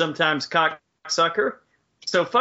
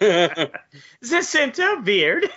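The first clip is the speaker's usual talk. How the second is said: laughing; much slower than usual. Retold or imitated. Mocking cohost's phrase, laughing.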